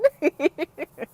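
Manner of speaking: high giggle, real amusement; short laugh after a playful remark. Real, not acted. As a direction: giggle lightly at your own silliness. Laughs after joking about glasses.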